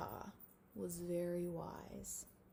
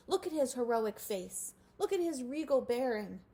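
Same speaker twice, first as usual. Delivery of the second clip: louder, insistent crowd; demanding; 6 semitones higher, 7 dB louder than usual. A narrator's reading. Mole rats insist Wilbur study Grandpa, loud demand. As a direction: press each look like a demand. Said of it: "they demanded".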